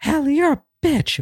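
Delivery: high, sneering mock diva; mock catty insult. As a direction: fling it like a catty drama queen. Mock catfight quote.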